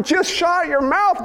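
ordinary talk

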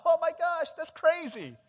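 high, loud astonishment; amazed outburst. Acted. Onlookers seeing a short leg grow out, loud amazement. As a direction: exclaim in thrilled disbelief. Acting amazed reaction to a healing.

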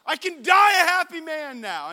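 loud, high exultant cry; joyful shout. Retold or imitated. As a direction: yell it with pure glee. Winner celebrating wildly.

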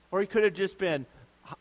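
usual speaking voice

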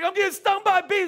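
high, loud retold panic; panicked yelling. Husband yelling to wife while bees sting him, panicked. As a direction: yell it as if under attack. Acting out his panicked answer.

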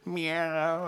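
high whiny meow; drawn-out plaintive cat cry; whining cat. Acted. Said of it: "It starts whining like cats do".

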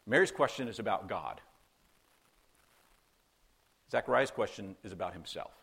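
usual speaking voice

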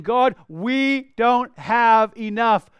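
slow, loud plea, each word spaced; emphatic retold petition; insistent prayer. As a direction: cry out to God, word by word.

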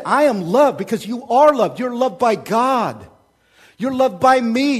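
loud, high, impassioned sermon voice; ringing pulpit declaration; full-force preaching. Acted. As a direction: pound home that they are loved. Preaching loudly on being loved.

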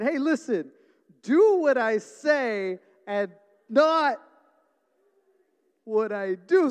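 loud, high mock parental lecture; irritated scolding. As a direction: lay down the law loudly, word by word. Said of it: "now I'm getting really irritated".

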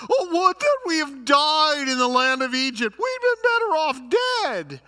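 loud, high mock wail; theatrical whining lament, high and loud. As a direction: whine it out like a hammed-up complainer. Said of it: "these people are the biggest whiners".

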